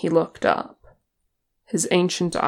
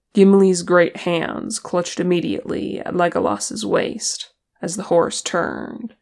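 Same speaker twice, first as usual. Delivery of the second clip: ordinary delivery, nothing marked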